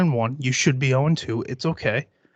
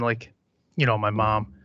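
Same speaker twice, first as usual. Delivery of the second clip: ordinary talk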